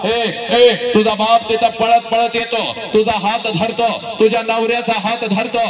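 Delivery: loud, driving sermon delivery; full-force preaching. Acted. Preacher tells the bride's farewell, loud and driving. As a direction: preach it out to the crowd.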